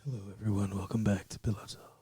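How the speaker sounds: low, soft late-night radio host; Pillow Talk voice. Pretend. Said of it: "trying to do the Pillow Talk voice?".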